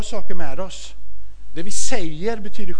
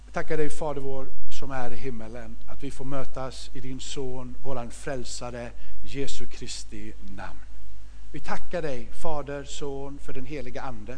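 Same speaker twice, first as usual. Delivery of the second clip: prayer; 4 semitones lower than usual. Acted. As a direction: pray gently with the congregation. Leder bön.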